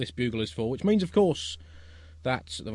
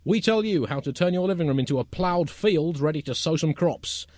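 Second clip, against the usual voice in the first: raised, emphatic magazine-show presentation; mock presenter; 5 semitones higher, 6 dB louder than usual. Presenter pitching indoor ecology to listeners, mock promotional delivery. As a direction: sell the tips to the audience.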